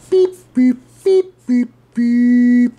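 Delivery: high electronic beeps, last one held long; imitated game beeps. Shown as a demonstration. Making own Pong sound effects, high loud beeps. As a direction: beep like an old arcade machine. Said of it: "make your own, uh, sound effects".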